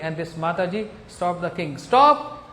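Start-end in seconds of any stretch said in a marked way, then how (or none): 1.8-2.4 loud, high-pitched command to stop; sudden forceful call; shouted halt. Retold or imitated. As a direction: shout to halt a passing army. Woman halts the king's army.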